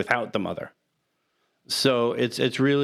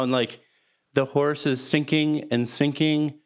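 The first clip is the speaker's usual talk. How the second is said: ordinary talk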